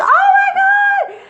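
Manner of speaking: high drawn-out mock shriek; panicked scream. Retold panic on seeing the man.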